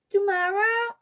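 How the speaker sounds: put-on high child's question; eager toddler. A narrator's reading. Fudge asking Mom if the baby comes tomorrow, high eager child voice. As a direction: ask it eagerly like a small kid.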